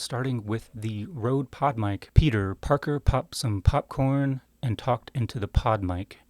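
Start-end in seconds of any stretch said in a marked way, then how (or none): 2.1-6.2 "Peter Parker popped some popcorn" said slowly, every p punched hard and aspirated; slow tongue twister with deliberately exploded p's in "popped" and "popcorn"; hard popping p's. Shown as a demonstration. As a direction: slowly spit each p of "Peter Parker popped" straight into the mic. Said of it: "let's do a plosive comparison".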